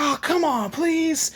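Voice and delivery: high, whining plea; pleading kid. Acted. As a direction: beg like a disappointed kid. Kid begs Naruto to teach him.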